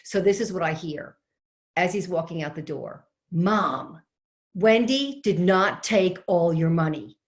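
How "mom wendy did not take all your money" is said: imitated phone shout, louder and slow; retold shout. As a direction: speak up to a mother who is hard of hearing. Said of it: "he's getting ready to scream".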